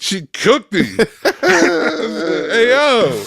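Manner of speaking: high-pitched, laughing exclamation; delighted laughing outburst; cracking up. Real, not acted. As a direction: burst out laughing, stretching the words out high. Cracking up at a savage comeback.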